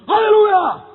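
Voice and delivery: high, loud praise yelled out; explosive shout. Retold or imitated. Pastor yells praise in an airplane toilet, explosive shout. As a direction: let it burst out uncontainably. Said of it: "dia teriak dulu di W C".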